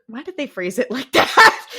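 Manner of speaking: loud, incredulous question cracking into laughter; laughing disbelief. Real, not acted. Podcaster mocks the show's phrasing, laughing in disbelief.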